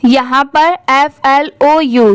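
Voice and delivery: ordinary delivery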